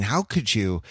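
ordinary talk

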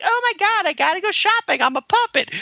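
very high goofy puppet voice; squeaky cartoon puppet, way up high. Mimicking a silly puppet from the sketch, very high. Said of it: "or, like".

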